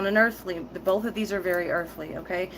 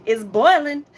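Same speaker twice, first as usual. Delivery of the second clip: louder and higher, a laughing burst; boiling over; 7 semitones higher, 10 dB louder than usual. Real, not acted. To viewers about warrior blood, laughing loud burst. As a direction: let the heat burst out. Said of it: "calm that warrior blood".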